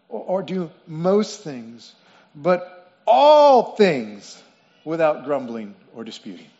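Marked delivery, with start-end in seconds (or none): 2.3-4.4 "all" drawn out long and loud; stress on all. Shown as a demonstration. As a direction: hit "all" hard and stretch it slowly. Contrasting all with some and most.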